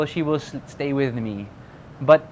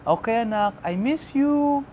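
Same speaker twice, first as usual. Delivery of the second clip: drawn-out, higher-pitched imitation of a mom; clingy mom; 6 semitones higher, slower than usual. Mom to her son on the phone, imitated clingy longing. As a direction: imitate a mother missing her child, voice lifted. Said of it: "when my mom calls me up".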